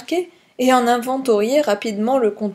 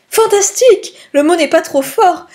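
high, gushing society lady; cooing rapture; 7 semitones higher, 5 dB louder than usual. A narrator's reading. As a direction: coo with ecstatic delight. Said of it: "roucoula missus Opalsen".

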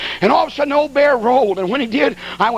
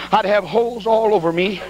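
ordinary delivery

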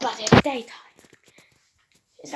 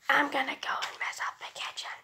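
fast sneaky robot whisper; 10 dB quieter, faster than usual. Acted. Robot plots mischief.

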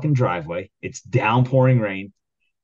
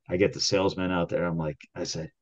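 ordinary delivery

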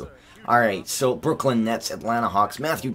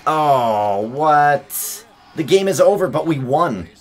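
higher, louder astonished cry; surprised shout; 5 semitones higher, 6 dB louder, slower than usual. Real, not acted. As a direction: react to the surprise win. Surprise at the win.

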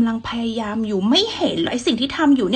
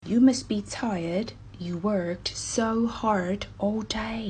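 very slow and softer test sentence; slow listening test read; 5 dB quieter, much slower than usual. Shown as a demonstration. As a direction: read it slowly for a listening quiz. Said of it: "ทดสอบกันดูค่ะ ว่าทุกคนจะฟังออกไหม".

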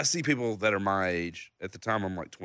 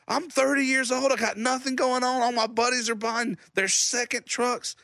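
much higher, mock outburst; coming unglued; 14 semitones higher than usual. Dan finally snapping at family on the boat, high mock outburst. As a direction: let it all burst out at them. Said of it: "I just come unglued".

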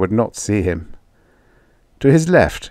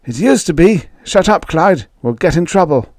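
nervous meek farmer; 9 semitones higher than usual. Acted. Meek farmer scolds friend.

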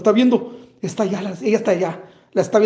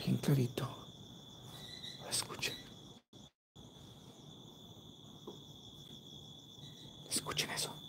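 susurro; 6 semitones lower, 24 dB quieter than usual. Real, not acted. Susurra tras oír un grito.

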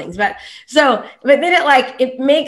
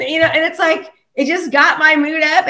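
ordinary talk

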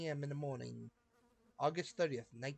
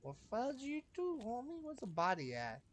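goofiest voice; 10 semitones higher than usual. Acted. Voicing the game agent at the sheriff in a goofy, high voice. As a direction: squeak it out as goofily as you can. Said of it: "give this dude the most goofiest sounding voice".

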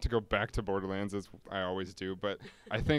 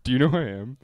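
louder mock star demanding recognition; entitled diva; 8 dB louder, faster than usual. Shown as a demonstration. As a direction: puff up like a pompous celebrity. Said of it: "You're gonna do it? [name], do it".

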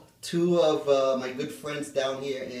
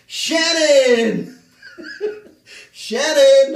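high, loud laughing call; laughing while calling her name; 10 semitones higher, 8 dB louder, much slower than usual. Real, not acted. Calling out a favorite friend.

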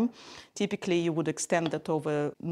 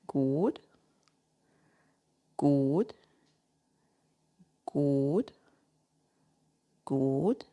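low, soft good with a rising lilt; calm steady encouragement; soft low praise; 6 semitones lower, 4 dB quieter, much slower than usual. Real, not acted. Praising dog during stay.

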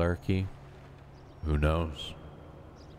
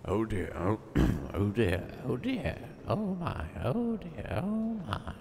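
put-on old lady voice; 6 semitones higher than usual.

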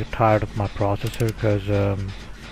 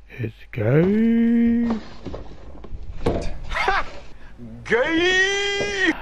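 mocking; 12 semitones higher, much slower than usual. Mocking joke.

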